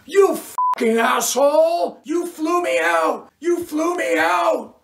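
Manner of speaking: high screaming rage; scorned woman screaming at her ex in a bar; furious shout. A narrator's reading. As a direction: shriek it at him across the table. Cheryl screams at Doug.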